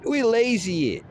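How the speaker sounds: high, cried-out plan; excited outburst. Pretend. Describing his fight tactic, he cries out excitedly.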